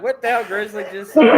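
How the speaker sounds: squealing through laughter, loud; mock shock, laughing it out; laughing mock outrage. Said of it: "everybody's just like".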